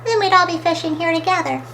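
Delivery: high, quick, mildly regretful; miserable. A narrator's reading. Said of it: "she thought miserably".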